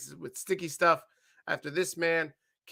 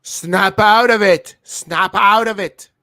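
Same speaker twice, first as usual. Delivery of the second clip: loud, drawn-out mock Scottish shout; slow, high, over-the-top Scottish impression; bad Glasgow accent; 5 semitones higher, 13 dB louder, slower than usual. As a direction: belt it out in an awful Glaswegian accent. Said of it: "That's my accent".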